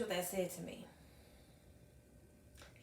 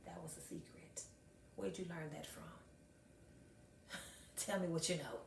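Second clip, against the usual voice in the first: whispered plea; 2 semitones lower, 12 dB quieter than usual. Retold or imitated. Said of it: "get on one knee and say".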